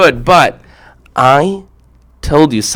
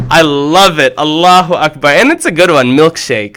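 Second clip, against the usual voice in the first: usual speaking voice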